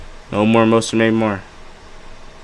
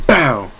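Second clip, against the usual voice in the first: sudden "bow" sliding down in pitch; imitated crash noise; 2 semitones higher than usual. Bear runs into the next tree, imitated crash noise. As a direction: throw it out as a cartoon impact.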